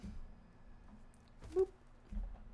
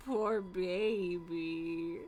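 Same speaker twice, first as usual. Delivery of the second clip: slow, drawn-out mock pity; 4 semitones lower, much slower than usual. Real, not acted. Owner cooing mock pity at her grumpy cat.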